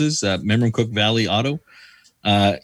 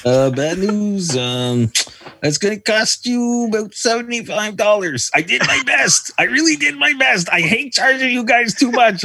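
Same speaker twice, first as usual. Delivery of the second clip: hammed-up French accent, much higher, slow on the bad news; 12 semitones higher, slower than usual. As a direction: play a French mechanic apologising for his prices. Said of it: "Are we doing accents?".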